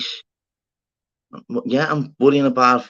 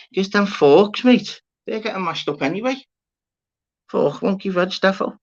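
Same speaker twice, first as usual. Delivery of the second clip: excited hype; 10 semitones higher than usual. Real, not acted. Streamer hyping wonky veg to his chat, excited and much higher. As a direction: get carried away selling wonky veg forks.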